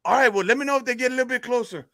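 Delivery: high Cajun buddy giving orders; Cajun joke character. Acted. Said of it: "get into this character here".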